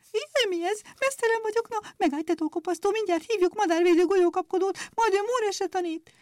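a very high, squeaky, indignant chirp; frightened chirping sparrows. A narrator's reading. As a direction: chirp in panic and threaten. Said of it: "rémülten csipogtak".

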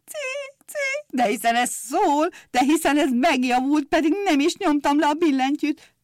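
put-on old organist shouting for joy at the note; joyful shout. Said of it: "örvendezve kiabált". (örvendező kiáltás)

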